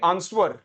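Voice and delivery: आंसर as 'aans-war', w sounded; common mispronunciation. Retold or imitated. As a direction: mimic the wrong आंसर with a 'w'. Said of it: "या तो बोलेंगे आंसर". (आंसर को गलत 'आंसवर' कहना)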